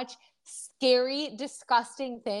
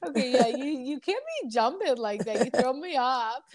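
laughing; slower than usual. Real, not acted. Laughing after co-host jumped.

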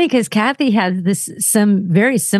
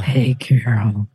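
very low, slow creepy caller; creepy old guy; 9 semitones lower, slower than usual. Retold or imitated. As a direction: drag the greeting down like a pervy old man on the phone. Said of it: "beginning of the creepiest phone call ever".

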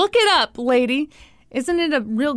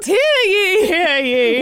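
loud, high proclaiming call; town crier; 5 semitones higher, 4 dB louder, slower than usual. Pretend. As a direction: announce it to the whole town. Mock royal proclamation.

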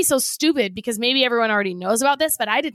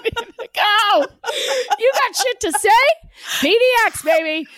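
hype; 9 semitones higher than usual. Real, not acted. Hyping co-host to go.

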